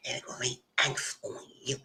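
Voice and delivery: breathy whispered threat of a spell; whispered witchy threat; whispered curse. Retold or imitated. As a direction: hiss it like a hex.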